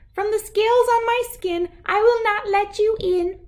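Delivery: very high, squeaky little fish voice; tiny fish voice. A narrator's reading. Little bass defying the big gar, very high squeak.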